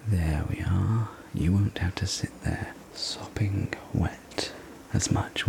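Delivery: usual speaking voice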